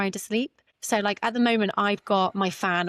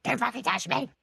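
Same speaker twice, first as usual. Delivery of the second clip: mock snap; 5 semitones higher than usual.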